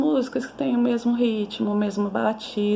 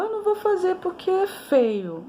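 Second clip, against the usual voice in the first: put-on much higher, whiny child; child's voice; 11 semitones higher than usual. Teacher imitating a child refusing to draw. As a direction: mimic a reluctant child. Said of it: "Toda criança que fala".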